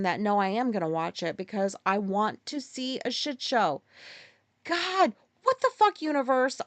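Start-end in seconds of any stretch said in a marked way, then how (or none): 4.6-6.6 exasperated outburst. Real, not acted. Vlogger yelling at the universe, exasperated and high. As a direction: pitch jumps way up, a real disbelieving cry. Cursing the universe over no rain.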